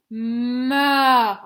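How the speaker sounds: "Ma" stretched into mmmaaa; sounding out the syllable. Shown as a demonstration. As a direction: hold the m, then swell loudly into a long a in "Ma". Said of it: "Ensuite, on entend A".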